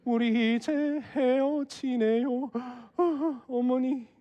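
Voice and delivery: soft, drawn-out weepy parting; acted weeping, quiet and slow; mock tearful farewell. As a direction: play a slow, hammed-up sob to mother-in-law. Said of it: "울면서 엄마한테 얘기하려고".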